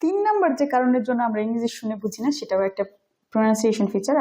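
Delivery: ordinary delivery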